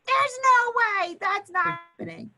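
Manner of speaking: stubborn refusal. Retold or imitated. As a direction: shut the idea down hard. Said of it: "and you'll be like".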